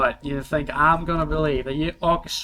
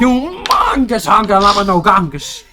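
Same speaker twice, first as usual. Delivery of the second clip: big announcer shout, higher and louder; hyped shout; 6 semitones higher, 11 dB louder than usual. Acted. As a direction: bellow it like a ring announcer. GM voicing a wrestling announcer.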